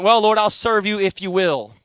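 a hammed-up deal-making prayer, rising fast at the end; mock bargaining prayer. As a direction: bargain with God, half-demanding. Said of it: "It wasn't".

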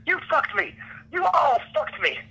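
high-pitched mock-angry yell; imitated outburst. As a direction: hurl a mock accusation way up high, without much volume. Mimics screaming man refusing calm.

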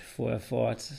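ordinary delivery

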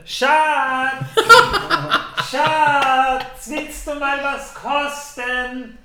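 laughing, high call to partner. Real, not acted. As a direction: call sweetly across the room.

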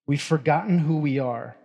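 ordinary talk

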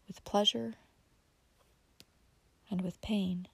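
soft unvoiced whisper. A narrator's reading. Narrator whispering a benediction over the remembered house. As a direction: breathe it like a blessing. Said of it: "as the whispering of benediction".

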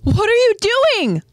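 high, loud cry of alarm; acted alarmed shout; yelling out. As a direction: yell it out to someone far off. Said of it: "Krista yells out to Ymir".

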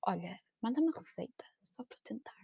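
soft whisper. Retold or imitated. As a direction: whisper the request. Pedido sussurrado à amiga. (pedido sussurrado)

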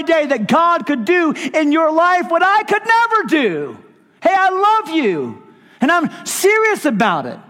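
high, loud impassioned sermon; preaching at full force. Acted. Preacher pleading his love to the congregation.

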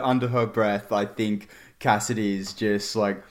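ordinary talk

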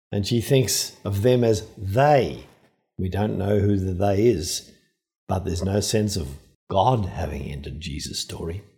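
usual speaking voice

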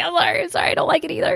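high, mock-flustered apologizing; apologizing too much. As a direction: squeak out a string of sorries. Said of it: "I keep apologizing for it".

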